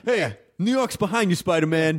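rally behind a hero in a mock blue-collar voice; crane worker.

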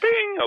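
very high chime imitation bing; ding like the cabin chime; mock airplane bell; seatbelt chime.

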